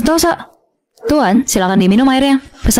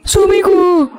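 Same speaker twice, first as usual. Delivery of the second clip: high, fake-tearful call; wailing wife; 8 semitones higher, much slower than usual. Stepmother wailing to husband, fake-tearful. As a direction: cry out for your husband.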